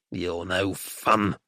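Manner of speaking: slow, low sulk; grudging, drawn-out complaint; grumpy. A narrator's reading. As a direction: grumble it like a thwarted old man. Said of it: "he said grumpily at last".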